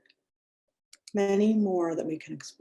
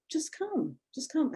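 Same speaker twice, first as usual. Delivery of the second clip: imitating coaxing teacher; 9 semitones higher, 5 dB quieter than usual. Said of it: "she's like".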